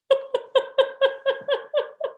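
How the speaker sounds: high, airy real laugh. Real, not acted. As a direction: laugh at your own joke. Laughs at own joke.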